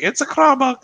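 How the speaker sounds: high mock woman's voice with foreign lilt. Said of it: "so she'll be like".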